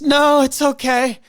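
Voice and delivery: high, slow scared squeak; imitating fear. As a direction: mimic her frightened little reply. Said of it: "Do you hear the fear in her voice?".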